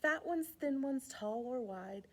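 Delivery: ordinary delivery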